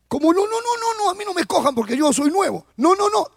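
high, rushed protesting no no no; panicked man backing away from the job; new convert refusing to be named pastor, squeaking high; frantic refusal. Retold or imitated. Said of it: "Decía él".

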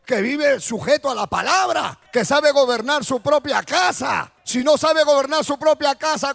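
a preacher thundering at the congregation; fiery preaching. Acted. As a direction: build the sermon to a shouting peak. Preaching at full force.

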